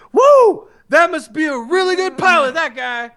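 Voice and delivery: high, loud impressed cheer; imitating Finn's happy whoop; excited whoop. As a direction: whoop like you just saw a great pilot. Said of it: "Finn's little one-liner where he's like".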